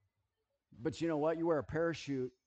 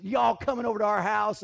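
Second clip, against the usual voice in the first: daughter voiced; 6 semitones higher, 10 dB louder than usual. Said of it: "she goes".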